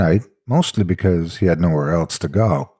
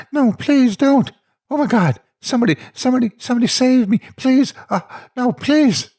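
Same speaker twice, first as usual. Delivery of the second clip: put-on child's terrified plea, much higher; higher, horrified. A narrator's reading. Child reliving the attack, high terrified pleading. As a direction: beg for your life in a high panicked voice. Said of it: "his voice changed to something higher and more horrified".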